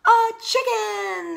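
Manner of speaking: loud, high, slow group shout. Acted. Frogs shouting at the hatchling, loud. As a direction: shout it out in amazement. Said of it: "they all shouted".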